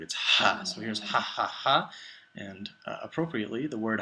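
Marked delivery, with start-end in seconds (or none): 0.0-0.6 breathy "ha" pushed out like after hot spice; spicy-food ha. Shown as a demonstration. Teacher demos "ha" for the class, like after spicy food. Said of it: "the sound you make after you eat spicy food". 1.2-1.9 "ha, ha" with a strong breathy h, a bit higher and louder; spicy-food ha. Shown as a demonstration. Teacher repeats "ha" for learners, like after spicy food. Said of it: "the sound you make after you eat spicy food".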